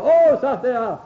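high, loud acted "oh"; delighted exclamation. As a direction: imitate a soldier's joyful surprise. Voices the corporal's delighted reaction.

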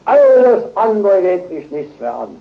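loud, high, eager cry of a zealot; preacher mocks a one-sided believer, loud and high; mock zealous believer. Mock proud believer, loud and high. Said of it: "und sagt".

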